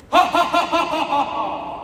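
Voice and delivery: loud villain's cackle that slows as it goes; evil laugh. Acted. Villain gloating over his cruel plan, evil cackle. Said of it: "What did I say about his laughter?".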